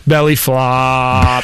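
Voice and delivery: slow, mock-dramatic belly flop; drawn-out echo. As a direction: stretch "flop" out long.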